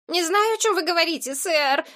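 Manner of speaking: high, pitiful pleading voice; plaintive boy. A narrator's reading. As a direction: whine it like a wronged little boy. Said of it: "жалобно проговорил мальчишка". (жалобный высокий голос мальчишки)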